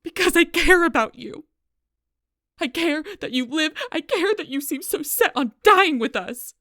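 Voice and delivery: loud, high-pitched desperate yell; anguished, heated outburst; impassioned shouting. Acted. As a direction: cry it out at full force. Impassioned confession in a quarrel.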